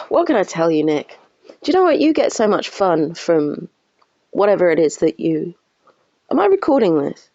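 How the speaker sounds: usual speaking voice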